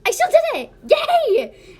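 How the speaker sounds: very high, loud cheer; delighted. Acted. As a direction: squeal in triumph. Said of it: "she said in delight".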